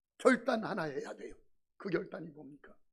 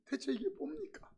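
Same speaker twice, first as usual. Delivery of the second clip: lament to God; 6 semitones higher, 4 dB quieter than usual. Retold or imitated. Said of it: "그냥 하소연만 나와요".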